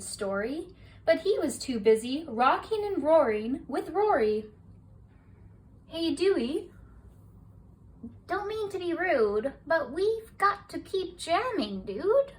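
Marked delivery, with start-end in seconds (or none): 5.9-12.3 higher, easygoing rocker dude; laid-back brush-off. A narrator's reading. Rocker Dewey telling Gil he's busy, laid-back higher voice. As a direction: shrug him off casually, dude.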